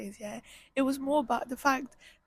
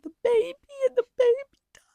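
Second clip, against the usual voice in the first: high, loud squeal collapsing into a sob; tearful breakdown; 12 semitones higher, 7 dB louder, faster than usual. Real, not acted. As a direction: voice climbs and cracks with grief. Breaks down over baby's death.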